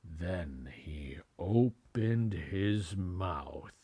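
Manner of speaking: drawn-out hushed suspense; slow whisper. A narrator's reading. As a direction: stretch every word in a whisper.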